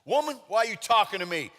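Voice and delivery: loud, high, sassy mock indignation; hammed-up rude retort; disrespectful. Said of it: "Kind of sounds disres".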